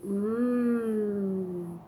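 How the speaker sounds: savoring mm; tasty mm. Shown as a demonstration. Showing a toddler the M sound on tasty food, mm. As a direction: hum like it tastes good. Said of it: "Something's tasty".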